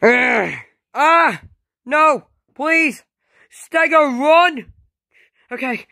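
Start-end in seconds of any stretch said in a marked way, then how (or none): 0.0-4.7 high, loud play-acted terror; high-pitched desperate yelling; panicked cries. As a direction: cry out as a victim under attack. Victim attacked, warns friend.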